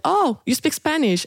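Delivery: high-pitched imitation of a delighted discovery; retold happy surprise; pleasantly surprised quote. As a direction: exclaim it high, as the friendly ticket lady. Retells train lady's pleased surprise.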